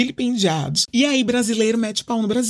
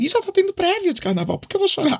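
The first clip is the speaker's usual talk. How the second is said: much higher, airy party voice; carefree Brazilian voice; 11 semitones higher, faster than usual. Retold or imitated. Voicing the typical Brazilian who parties instead of crying. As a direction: brush off sadness with carnival glee. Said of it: "Mas existe um".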